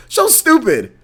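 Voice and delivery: dismissive. Real, not acted. Ranting about the show.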